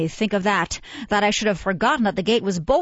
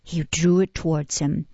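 plain reading voice

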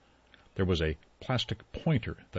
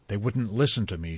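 plain reading voice

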